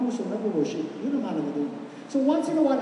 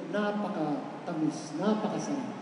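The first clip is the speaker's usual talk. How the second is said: soft, slow, lower and sweet; tender; 7 semitones lower, 7 dB quieter, slower than usual. Shown as a demonstration. Describing how God hears a frog voice, soft and slow. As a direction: savour each word softly. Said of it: "sa tenga ng Diyos".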